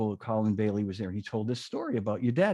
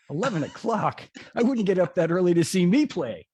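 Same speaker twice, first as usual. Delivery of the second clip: scoffing; 5 semitones higher, 4 dB louder than usual. Retold or imitated. Buddy's scoffing punchline.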